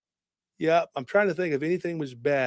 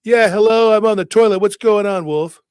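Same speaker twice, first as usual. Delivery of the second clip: loud, higher Trump voice; Trump impression; 5 semitones higher, 8 dB louder than usual. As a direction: boom it out like Trump. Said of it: "He's like".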